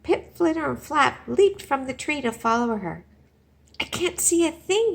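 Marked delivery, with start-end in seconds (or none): 3.8-4.9 high, loud panicked yell. Acted. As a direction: yell it out in the dark. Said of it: "yelled Pip".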